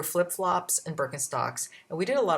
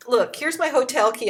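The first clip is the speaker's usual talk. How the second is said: urgent plea; 7 semitones higher, 6 dB louder than usual. Retold or imitated. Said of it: "And I said".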